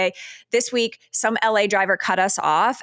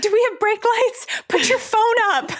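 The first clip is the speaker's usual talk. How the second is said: high, panicky squeal of a question; hammed-up high-pitched alarm; mock panic; 12 semitones higher than usual. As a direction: squeak it out like you're sure a car will hit you. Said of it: "And we're like".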